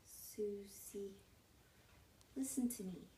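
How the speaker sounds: whispered name, barely any voice; very soft, secretive call; hushed address. Acted. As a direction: whisper it to get someone's attention privately. Urgent private address in the bathroom.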